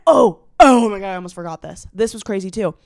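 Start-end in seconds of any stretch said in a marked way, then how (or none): 0.1-1.1 loud burst of surprised recall; loud, startled OH; sudden realisation. Real, not acted. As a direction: blurt it out as it hits you. Suddenly remembers a forgotten detail.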